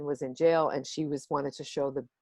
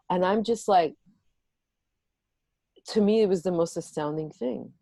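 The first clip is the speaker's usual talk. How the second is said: ordinary talk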